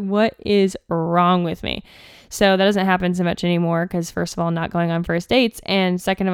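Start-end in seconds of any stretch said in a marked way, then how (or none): 0.0-1.8 slow bewildered self-question. Retold or imitated. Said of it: "And I was like".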